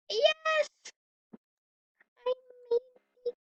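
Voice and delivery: high, loud triumphant yes; excited cheer. Real, not acted. Girl clears a tricky jump and cheers, high and loud.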